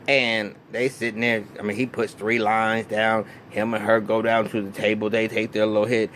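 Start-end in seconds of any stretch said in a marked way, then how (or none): none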